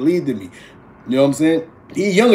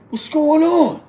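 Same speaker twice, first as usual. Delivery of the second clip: dad answering phone, raised; 12 semitones higher, 3 dB louder, slower than usual. Retold or imitated.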